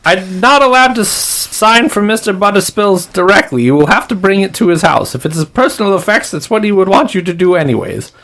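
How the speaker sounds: very high, fast official voice; odd, high-pitched, hurried gatekeeper; weird attendant. Acted. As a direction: rattle off the rules in a strange high pitch. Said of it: "they sound weird".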